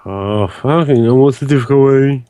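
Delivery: ordinary delivery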